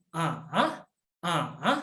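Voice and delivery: A dipping low, then rising; A in a tick-shaped pitch, down then up; third tone A. Shown as a demonstration. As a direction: drop A down and bring it back up. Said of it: "Nada tiga itu".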